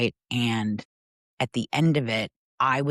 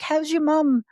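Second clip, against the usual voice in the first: high, bright, louder caring question from the baker; friendly baker; 5 dB louder than usual. Retold or imitated. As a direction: chirp it up high like the warm shopkeeper greeting you. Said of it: "the baker would be like".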